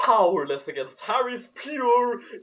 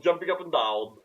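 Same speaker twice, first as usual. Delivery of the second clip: ordinary talk, nothing marked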